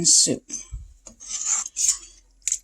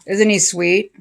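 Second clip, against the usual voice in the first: ordinary talk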